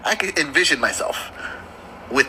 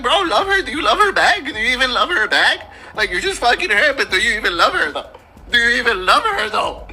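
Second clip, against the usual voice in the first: higher-pitched, exaggerated upset demand; emotional pleading; 4 semitones higher than usual. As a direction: plead as if personally heartbroken. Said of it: "I'm over here emotional".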